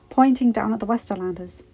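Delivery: plain reading voice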